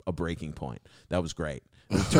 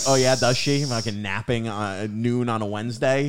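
louder, raised skeptical questioning; mock sarcasm; 4 semitones higher, 6 dB louder than usual. Worker answering the husband's stress excuse, loud sarcasm. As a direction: throw the excuse back sarcastically.

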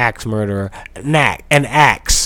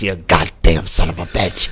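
put-on old movie villain voice; 6 semitones lower than usual. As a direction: snarl it like a hammy film heavy. Mock killer threat, movie insult.

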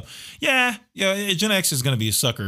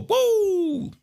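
high, drawn-out woo; celebratory high "woo"; excited whoop. Real, not acted. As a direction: cheer with a long high whoop. Cheers the next movie.

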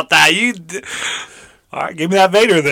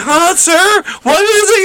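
confused dummy voice; 12 semitones higher, slower than usual. Retold or imitated. Imitating own confused reply.

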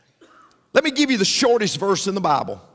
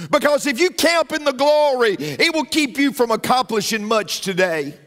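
higher-pitched, driving pulpit delivery; impassioned preaching; 5 semitones higher than usual. Real, not acted. Preacher urging congregation to move on past the glory, impassioned. Preacher builds to full force.

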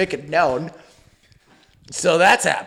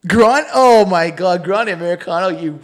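louder, mocking a chatty customer at the counter; mocking zany chatter; 6 dB louder than usual. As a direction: ham up the friend's chatter with the barista. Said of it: "You know, like, zany guy".

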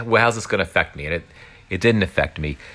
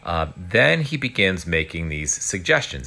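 usual speaking voice

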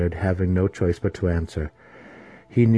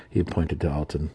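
ordinary talk, nothing marked

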